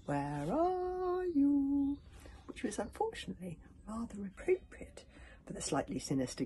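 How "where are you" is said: high, very slow drawn-out call; eerie bird call. A narrator's reading. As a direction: stretch it like a haunting bird cry. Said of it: "the cry of the Neverbirds".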